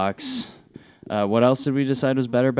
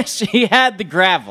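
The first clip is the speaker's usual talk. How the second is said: laughing; 9 semitones higher than usual. Retold or imitated. Repeats line laughing.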